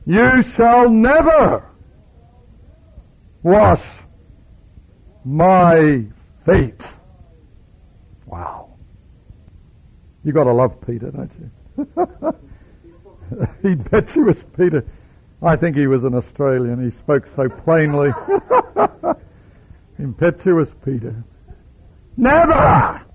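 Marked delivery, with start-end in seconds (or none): 0.1-6.9 slow, high, loud, never hammered; emphatic refusal. Acted. Peter building up his steam against Jesus, slow emphatic refusal. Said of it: "he now emphatically says". 22.1-23.0 high, loud indignant never; outraged cry. Acted. Peter refusing outright, high loud cry.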